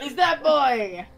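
high, loud, drawn-out echo; excited shout. Pretend. Player recognising that boy, excited shout. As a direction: cry it out slowly with excitement.

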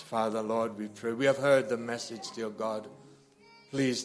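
ordinary talk

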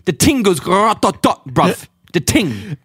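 loud, hammed-up Cockney street talk; Cockney accent. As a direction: play up a London Cockney bruv. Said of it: "a little bit Cockney".

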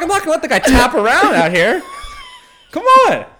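loud, high, hammed-up argument; indignant protest. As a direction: shout back at your friend, climbing to a yelp. Acts arguing back at his friend.

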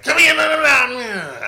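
loud, high, hammed-up standup voice; mock a comic working the crowd; comedian voice. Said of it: "the comedian voice".

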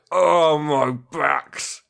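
slow, lightly voiced moaning back; a hint of a whining back; talking back voice. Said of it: "if it could speak".

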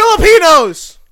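high, very loud blurt; shouted answer. Real, not acted. Friend answering who he could mock, shouted punchline. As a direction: yell it as the punchline.

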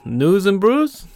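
high-pitched, drawn-out bad Boston accent; slow it right down and pitch up a hammy Boston accent; badly faked Boston accent, rising like a question; terrible Boston accent. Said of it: "that's a terrible Boston accent".

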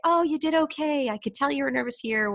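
imitated friendly feedback. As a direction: play a friend offering gentle reassurance. Voices friends' kind feedback.

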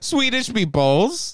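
put-on high, slow, sing-song Swedish accent; mock Swedish accent. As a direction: ham up a Swedish accent on the meatballs. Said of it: "they have Swedish accents".

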